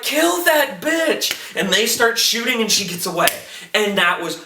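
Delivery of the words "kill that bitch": high, slow, stretched-out yell; mock yelled cheer. Viewer cheering on the shooters, mock yelling. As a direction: egg them on in a pitched-up shout. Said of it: "I'm like".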